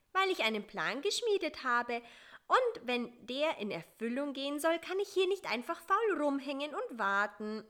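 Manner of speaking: a fly bursting with plans; energetic, go-getter push; full of drive. A narrator's reading. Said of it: "voller Tatendrang".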